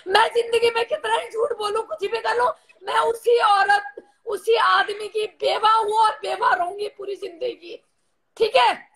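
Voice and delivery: tearful, insistent vow; crying. Real, not acted. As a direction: declare your loyalty while really crying. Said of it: "रो मत". (रोते हुए दृढ़ता से)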